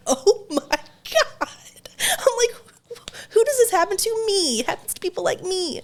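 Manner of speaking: high, loud mock despair; mortified. Mom realizes she forgot underwear, high loud despair. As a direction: wail it to the heavens.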